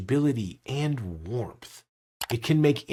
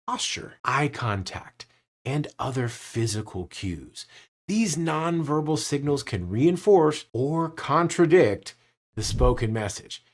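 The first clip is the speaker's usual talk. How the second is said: ordinary talk, nothing marked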